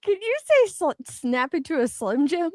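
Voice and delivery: usual speaking voice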